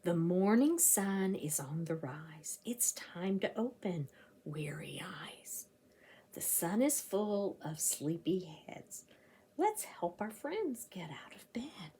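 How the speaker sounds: soft, hushed rhyming storybook read; reading to children. A narrator's reading. Librarian reading the farm book to children, soft and hushed. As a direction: read the rhyme gently to little listeners.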